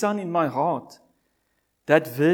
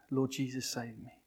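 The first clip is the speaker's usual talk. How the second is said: soft, lower plea; hushed prayer; 5 semitones lower, 9 dB quieter than usual. Retold or imitated. Preacher recalling his lone cry to Jesus, hushed. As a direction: breathe the prayer quietly.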